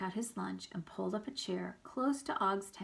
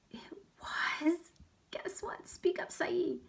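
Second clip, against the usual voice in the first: hushed voices; 11 semitones higher, slower than usual. Acted. Teacher voices quiet student exchange.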